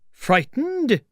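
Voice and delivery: very high, loud, falling exclamation; indignant outburst. Acted. Tramp offended by the unseen voice, indignant outburst. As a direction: cry the word out in indignation.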